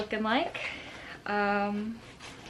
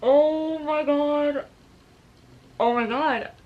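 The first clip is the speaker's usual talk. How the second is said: awed; 4 semitones higher, 5 dB louder, much slower than usual. Real, not acted. Still overwhelmed by gift.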